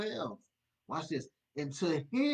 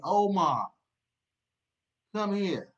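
calling by name; 5 semitones higher, 8 dB louder, slower than usual. Acted. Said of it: "He know your name".

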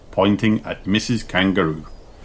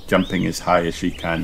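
plain reading voice

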